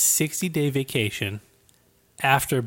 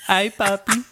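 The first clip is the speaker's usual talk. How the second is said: high puppy-talk greeting; 9 semitones higher, 5 dB louder than usual. Pretend.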